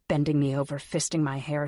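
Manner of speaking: plain reading voice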